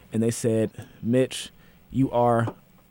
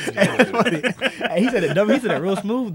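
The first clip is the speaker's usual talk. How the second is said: high, loud laughing talk; cracking up while talking; laughing hard; 10 semitones higher, 5 dB louder, slower than usual. Real, not acted. Laughing at the smooth repeat.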